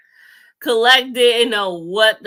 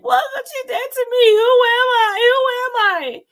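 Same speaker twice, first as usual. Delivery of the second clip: high mock crying wail. Tara sobbing to herself, hammed-up high wail. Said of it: "she's like".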